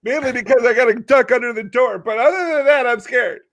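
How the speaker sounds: high, frightened basketball player; mock-frightened high voice; scared character voice. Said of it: "very scared".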